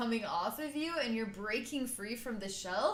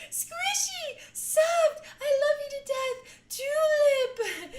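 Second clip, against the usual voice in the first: squealing high and loud with delight; gushing adoration; 9 dB louder, slower than usual. Retold or imitated. Cooing at the squishy Tulip, high squealing delight. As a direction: gush over her like a cooing fan. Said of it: "I'm like".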